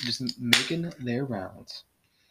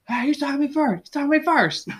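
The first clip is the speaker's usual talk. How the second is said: very high, whiny-kid mock protest; Phillip Rivers; 4 dB louder than usual. Friend imitating quarterback squabbling with a defender, high mock protest. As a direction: imitate an indignant trash-talker, pitched way up. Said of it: "He's, like, talking trash to some D B".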